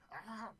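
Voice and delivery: startled yelp. Real, not acted. Sudden realization, recording.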